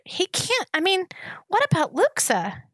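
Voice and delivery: can't believe the friend's a traitor; shocked protest. A narrator's reading. Gregor protests the traitor to his friend, pitched high and disbelieving.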